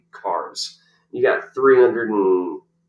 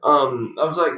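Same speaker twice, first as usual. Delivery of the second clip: usual speaking voice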